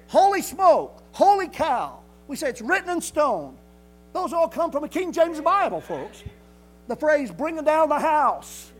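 loud, rising pulpit delivery, hammering each phrase; preaching at full force. Acted. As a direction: drive every saying home to the congregation.

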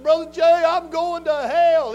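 put-on a crying young man's plea; desperate plea. Young man bawling at preacher's door, loud despairing plea.

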